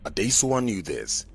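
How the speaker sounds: plain reading voice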